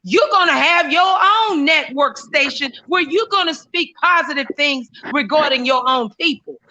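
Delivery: ordinary delivery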